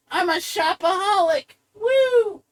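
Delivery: high, giddy consumer squeal; sarcastic high-pitched party cheer; mock shopaholic. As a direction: whoop it up like a gleeful shopper. Mocks a happy consumer.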